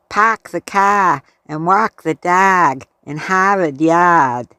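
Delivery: a hammed-up Boston accent, dropping the Rs; poor Boston accent. Imitating how Bostonians say it, weak Boston accent. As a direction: put on a bad Boston accent for laughs. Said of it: "Sorry for the poor accent".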